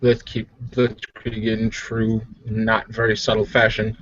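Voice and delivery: ordinary delivery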